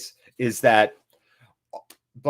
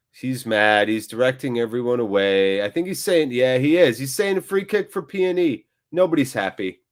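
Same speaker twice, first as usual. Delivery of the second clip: ordinary delivery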